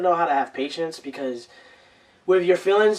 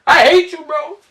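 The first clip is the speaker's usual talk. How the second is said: mock rage, building higher and louder; acted angry outburst; 9 semitones higher, 5 dB louder than usual. Host acting out speaking from raw feelings, angry outburst. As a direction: blurt it out as a heated, high-pitched mock yell.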